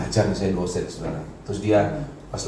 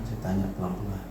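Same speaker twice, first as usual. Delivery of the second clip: soft, lowered demonstration of gentle asking; gently hushed; 4 semitones lower, 9 dB quieter than usual. Shown as a demonstration. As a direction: drop low and soft.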